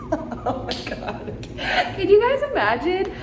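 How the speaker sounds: high-pitched laughter breaking into the words; real giggling. Real, not acted. Imagining the server finding this vlog, giggling helplessly. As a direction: crack up while talking.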